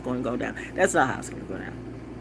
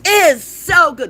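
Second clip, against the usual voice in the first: much higher, louder, slow so good; delighted; 14 semitones higher, 9 dB louder, much slower than usual. Real, not acted. Raving to viewers about the pie's bottom. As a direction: stretch out so good with glee. Savoring dessert.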